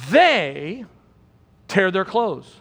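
THEY held alone, then a pause; stress on THEY. Shown as a demonstration. Preacher points the congregation to who grieved, THEY strongly stressed. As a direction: lean hard on THEY and let it hang before the rest. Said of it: "why are we emphasizing the they".

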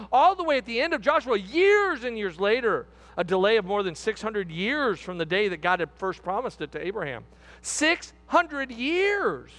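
high, driving pulpit delivery; fervent preacher hammering the point; impassioned preaching. Real, not acted. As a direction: preach emphatically, stretching out key words. Preaches at full force.

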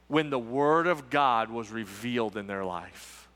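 low, soft, drawn-out sermon climax with long pauses; hushed preaching. Acted. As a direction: bring the room close with a quiet, grave reveal.

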